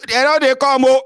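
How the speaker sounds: very high, loud warning call; mock alarm cry. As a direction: yell the warning as if men are coming. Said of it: "they will say".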